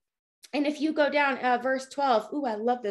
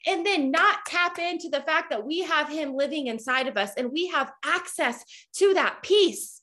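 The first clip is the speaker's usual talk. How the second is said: normal talking voice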